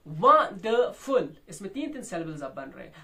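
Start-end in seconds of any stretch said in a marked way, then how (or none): 0.1-1.4 wonderful slowly by syllables, loud वं; wonderful in three separate beats, first strongest; strong-weak-weak stress. Shown as a demonstration. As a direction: punch the first beat of wonderful. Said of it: "पहला strong है".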